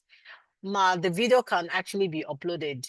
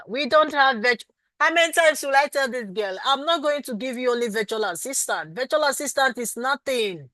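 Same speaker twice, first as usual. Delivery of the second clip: raised high, loud scolding of a repeat question; exasperated; 6 semitones higher, 5 dB louder than usual. Real, not acted. Teacher to a student who asks about virtual, exasperated loud scolding. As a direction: scold her for asking again, voice up and loud.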